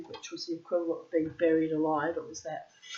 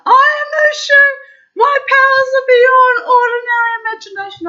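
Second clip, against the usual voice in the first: much higher, much louder sing-song toy-dragon yell; 20 dB louder than usual. Retold or imitated. Toy dragon yelling at the cop it just hit, sing-song shriek. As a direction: blare it out like a toy shrieking on impact. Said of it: "it would start yelling out".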